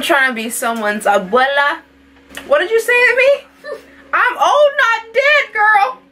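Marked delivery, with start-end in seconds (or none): 2.5-6.0 sassy grandma. Pretend. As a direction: snap back like a feisty granny.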